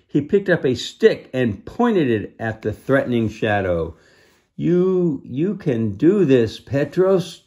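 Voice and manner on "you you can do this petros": higher, louder pleading encouragement; urgent cry. A narrator's reading. Clara crying out encouragement to Petros in the fight. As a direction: call out to him to hold on. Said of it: "Clara cried".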